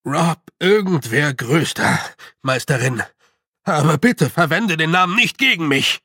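nervous chieftain confessing his name; anxious clan leader. A narrator's reading. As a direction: blurt out the name, then plead. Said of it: "er schluckte".

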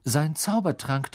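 ordinary delivery

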